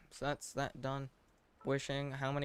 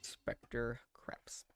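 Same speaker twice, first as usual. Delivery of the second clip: whispered aside; 3 dB quieter than usual. Real, not acted. Repeating his joke name quietly.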